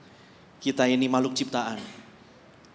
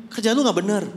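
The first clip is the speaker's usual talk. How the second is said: mock sneer; 6 semitones higher, 5 dB louder than usual. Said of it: "Nah, gini".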